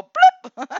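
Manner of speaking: very high loud cartoon bloop; comic bloop. Her money antenna going up, a high loud bloop. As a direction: pop it out like an alarm going off. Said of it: "my antenna go".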